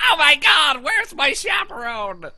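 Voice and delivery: high, loud panicked wail, played for laughs; hammed-up panic of a lost schoolkid; mock freak-out. Teasing Evan as a kid looking for his teacher, mock freak-out. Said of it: "Evan was freaking out".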